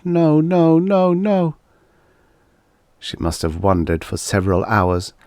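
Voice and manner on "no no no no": high sobbing no's; sobbing mutter. A narrator's reading. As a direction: repeat it as she sobs. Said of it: "sobbing and muttering aloud".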